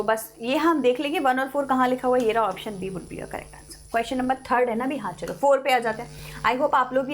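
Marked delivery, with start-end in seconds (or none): none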